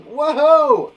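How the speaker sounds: loud, high celebratory cheering; excitement. Shown as a demonstration. Teacher showing pupils excited exclamations, cheering. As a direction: whoop wildly with a laugh. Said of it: "it's exciting".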